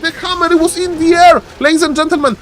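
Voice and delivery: high-pitched excited casting; excited play-by-play. Real, not acted. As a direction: call the sudden play, pitch way up. Caster reacts to sudden play.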